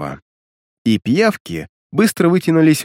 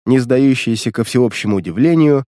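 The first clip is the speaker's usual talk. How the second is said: ordinary delivery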